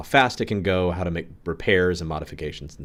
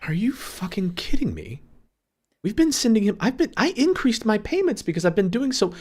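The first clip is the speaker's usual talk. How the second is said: high-pitched, louder indignant outburst; outraged; 5 dB louder than usual. Acted. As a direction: play it incredulous and worked up, voice way up high. Player acting outraged in role.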